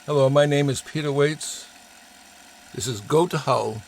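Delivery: ordinary delivery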